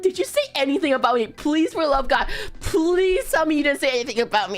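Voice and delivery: fast, pressing begging; retold urgent plea. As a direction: rattle it off as a desperate-sounding plea.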